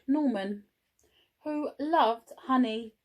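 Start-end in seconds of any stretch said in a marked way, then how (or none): none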